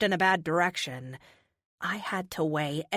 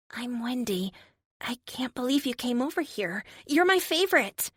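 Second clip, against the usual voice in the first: lightly voiced starstruck little girl, higher; a hint of a shy girl turning giddy; 6 semitones higher than usual. Wheelchair girl meeting her armored hero, lightly voiced high girl.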